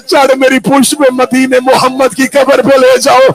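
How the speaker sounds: high, loud crying plea; weeping plea. Acted. Horse weeping as it begs Husain. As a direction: beg through tears. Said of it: "रो के कहता".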